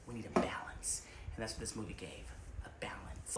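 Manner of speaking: soft breathy aside; hushed emphasis; whisper. Acted. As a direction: drop to a secret whisper.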